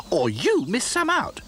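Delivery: slow, high indignant retort; rather hotly. A narrator's reading. Dotty answering Back to Front, slow and indignant. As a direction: bristle back at him. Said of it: "rather hotly".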